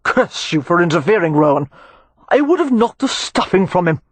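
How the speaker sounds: furious brawler cursing Rohan; growled. Acted. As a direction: snarl at the interferer. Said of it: "he growled".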